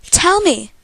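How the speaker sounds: loud, high, urgent demand; near-screamed demand. A narrator's reading. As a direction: demand the answer at nearly a scream. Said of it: "I practically scream".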